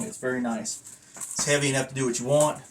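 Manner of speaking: usual speaking voice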